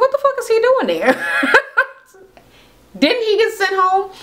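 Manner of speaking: very high, laughing disbelief; incredulous mock screech. [name] spotting the boyfriend back inside, mock-incredulous high squeal.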